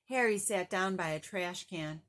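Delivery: usual speaking voice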